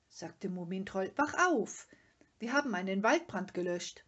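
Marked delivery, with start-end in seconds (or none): 1.2-4.0 loud, urgent breaking news; high start, then rush out the news; excited wake-up call. A narrator's reading. Troll wakes father with news. (aufgeregt weckend)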